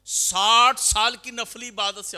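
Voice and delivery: slow, high, loud sermon point; preaching at full force. Acted. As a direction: hammer home the teaching slowly. Preacher stresses key teaching.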